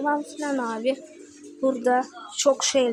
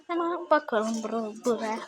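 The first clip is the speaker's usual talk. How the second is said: usual speaking voice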